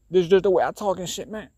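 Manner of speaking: hammed-up, high-voiced slangy guy impersonation. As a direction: put on a high voice imitating some guy's slang. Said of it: "guy being like".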